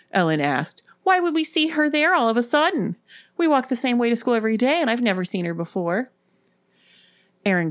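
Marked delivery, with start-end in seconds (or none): 1.0-6.1 high, agitated protest easing at the end; disbelieving. A narrator's reading. Ellen puzzling over the sudden sighting, in disbelief. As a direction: question it in raised-pitch disbelief. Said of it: "looked at each other in disbelief".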